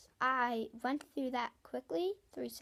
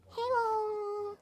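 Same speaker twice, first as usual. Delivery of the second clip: burp sound; 10 semitones higher, 4 dB louder than usual. Shown as a demonstration. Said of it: "this is my second one".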